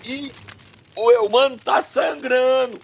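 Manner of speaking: ordinary talk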